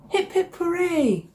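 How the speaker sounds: high, bright cheer. A narrator's reading. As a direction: cheer for the found wand. Frog finds the wand.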